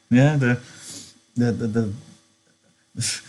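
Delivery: ordinary delivery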